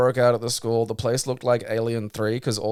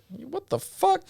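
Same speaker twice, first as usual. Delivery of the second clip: high-pitched what the fuck, far higher; shocked outburst; slower than usual. Retold or imitated. Reading an article and reacting in shock, high disbelief. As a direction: squeak it out in disbelief. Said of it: "I'm like".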